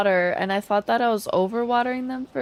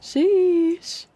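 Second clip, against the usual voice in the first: surprised sheesh; 9 semitones higher, 4 dB louder than usual. Real, not acted. Reacting to tornado damage.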